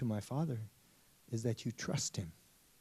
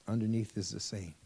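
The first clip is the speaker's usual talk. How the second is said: ordinary talk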